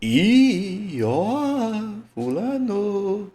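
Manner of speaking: high, drawn-out hailing call. Shown as a demonstration. Teacher shows how to call the word, high drawn-out call. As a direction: stretch every vowel as if hailing someone far away. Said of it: "Vai chamar a palavra".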